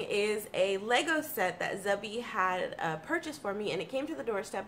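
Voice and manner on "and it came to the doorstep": usual speaking voice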